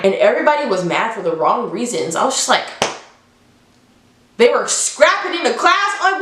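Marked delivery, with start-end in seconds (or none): none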